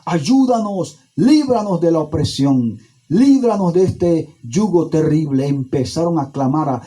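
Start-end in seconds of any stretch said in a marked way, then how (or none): 0.1-5.5 high, pleading cry to God; acted crying out. Israelites begging God to free them from oppression, crying out. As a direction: call out for deliverance, voice pitched high. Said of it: "a llorar, a gritar".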